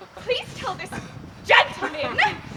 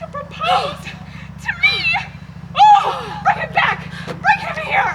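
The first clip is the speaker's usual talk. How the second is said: frantic shout; 8 semitones higher, slower than usual. Acted. Daughter suddenly wanting the suitor back, high and frantic. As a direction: scream for him to come back. Daughter hears of proposal, panics.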